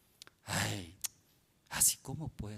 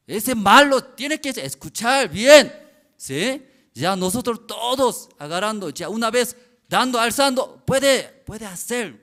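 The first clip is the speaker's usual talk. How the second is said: loud preaching; 9 semitones higher, 18 dB louder than usual. Retold or imitated. Pastor shouts at the evil one while they lift.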